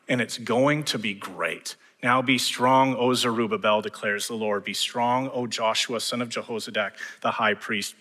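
usual speaking voice

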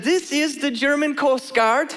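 slow, high-pitched mock German accent; German-accented coast guard radio operator. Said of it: "I have to do the accent".

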